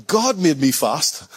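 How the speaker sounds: unhurried, weighty delivery; drawn out and sincere; slow, savored quote. Acted. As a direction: let each word land slowly. Quotes the runner, slowly.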